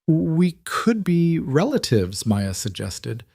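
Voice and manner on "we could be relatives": put-on slow child voice. Maya, a girl, suggests.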